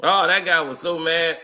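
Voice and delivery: ordinary talk, nothing marked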